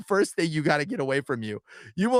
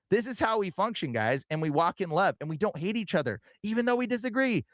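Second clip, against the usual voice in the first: normal talking voice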